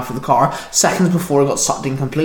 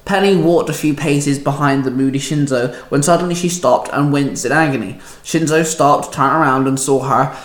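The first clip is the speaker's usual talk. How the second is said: ordinary delivery, nothing marked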